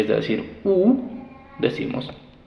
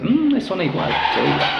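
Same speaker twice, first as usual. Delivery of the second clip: imitating a doubtful student; 8 semitones higher, faster than usual. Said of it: "No digas".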